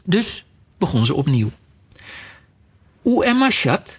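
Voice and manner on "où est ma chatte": high, carefully recited French question; schoolbook French. Acted. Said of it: "de eerste zin was in haar Franse schoolboek".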